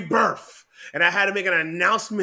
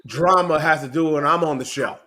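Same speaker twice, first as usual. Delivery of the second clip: loud rant; 5 dB louder than usual. Acted. Comic rant about attention.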